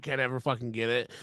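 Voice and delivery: ordinary talk, nothing marked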